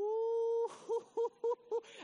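a burst of high, loud laughter. Real, not acted. Preacher laughing at his own joke, high and loud. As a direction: crack up loudly.

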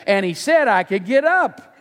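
put-on high, drawn-out childish plea; little daughter. Daughter bargaining with dad to get up, high drawn-out child voice. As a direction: add it like a stalling little girl. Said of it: "she added".

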